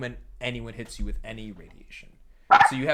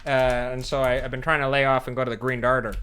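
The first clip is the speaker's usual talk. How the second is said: loud, fast Chicago guy voice; a thick Chicago accent, rattled off quickly; 10 dB louder, faster than usual. Acted. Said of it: "Great accent work".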